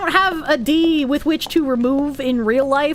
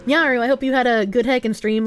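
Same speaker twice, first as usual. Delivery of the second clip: normal talking voice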